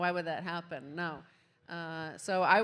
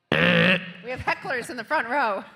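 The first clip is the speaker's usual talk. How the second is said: loud joking callout to the crowd; 3 semitones higher, 11 dB louder than usual. Real, not acted. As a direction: call it out over the room. Calling out to crowd.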